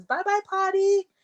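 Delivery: put-on toddler voice. Toddler voiced waving off potty.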